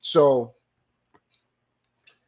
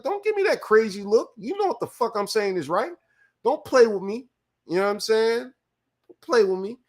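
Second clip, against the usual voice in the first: higher, heated mock warning; retold confrontation; 8 semitones higher than usual. To the clerk giving him a look, a heated high warning. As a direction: warn her off with raised pitch.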